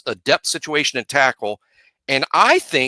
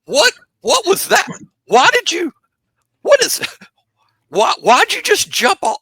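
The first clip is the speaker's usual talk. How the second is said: much higher, mock-shocked squeal of disbelief; baffled listener; 5 dB louder than usual. Mimicking a shocked viewer of the speech, high mock disbelief. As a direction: play the stunned audience who can't believe what they heard. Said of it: "And you're like".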